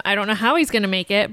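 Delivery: ordinary talk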